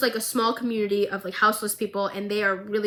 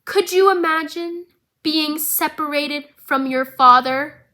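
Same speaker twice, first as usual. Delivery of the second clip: menacing villain; 5 semitones higher, 5 dB louder than usual. Retold or imitated. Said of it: "he looks at her and goes".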